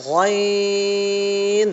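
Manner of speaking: গইন long, in a thick voice; thick ghain. Shown as a demonstration. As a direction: read গইন with a thicker, heavier sound. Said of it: "একটু মোটা আওয়াজ দিয়ে পড়বো".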